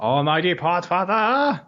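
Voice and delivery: slow, hammed-up reverent hail; grand proclamation. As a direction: proclaim it like a title. Grand hailing of title.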